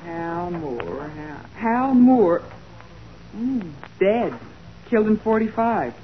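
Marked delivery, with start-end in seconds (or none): 0.0-1.1 low, soft murmur to oneself; searching mutter. Acted. Assistant looking up a suspect in the files, muttering. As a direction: read the name under your breath, low.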